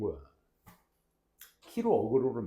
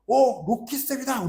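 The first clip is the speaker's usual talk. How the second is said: high, louder, slow call down the corridor; calling out from the hallway; 10 semitones higher, 6 dB louder, slower than usual. Retold or imitated. Students spot teacher from hallway end, excited call. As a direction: shout across the hall like an excited kid. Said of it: "그러는 거야".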